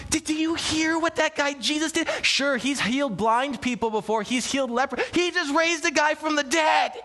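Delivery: imitating an amazed crowd, high-pitched; crowd going wild. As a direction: build to an astonished high exclamation. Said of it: "the people are going wild".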